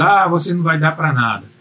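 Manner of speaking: normal talking voice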